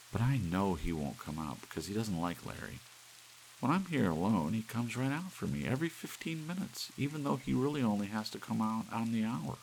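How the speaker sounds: a secret shared with delight; Doris confiding. Acted. Doris, very high.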